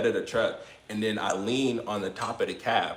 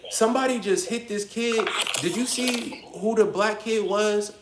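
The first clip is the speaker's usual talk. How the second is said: much higher, put-on young questioning voice; imitated kid's voice; 9 semitones higher than usual. Kid asking the group who hit his friend, high put-on voice. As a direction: play the kid asking innocently, pitched up.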